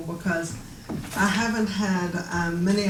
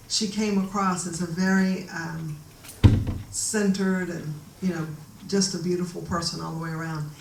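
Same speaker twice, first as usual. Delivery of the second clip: ordinary talk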